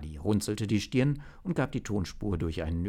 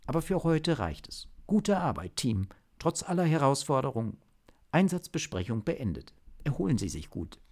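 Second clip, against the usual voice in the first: higher-pitched businesslike chief; official chief; 6 semitones higher than usual. Acted. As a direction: speak as the chief issuing a formal statement. (sachliche Chefin, höhere Stimme)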